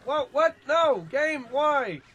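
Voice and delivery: real exasperated shout, pitched high; frustrated yell. Real, not acted. Gamer yelling at the game as the horse bolts, high exasperated shout. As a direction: yell at the screen in disbelief.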